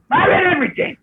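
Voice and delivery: high-pitched imitated producer. Producer answering the writer's question, imitated in a high voice. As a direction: toss out the claim in a high put-on voice. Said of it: "He went".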